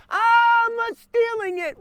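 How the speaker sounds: very high, hammed-up holler; mock shouted reply. Thief answering the wife while reversing away, high mock shout.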